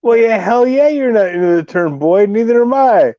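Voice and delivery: high, swooping exaggerated agreement; incredulous, high-pitched mimicry; mocking echo. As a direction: parrot it back way up in pitch. Echoes comment in disbelief.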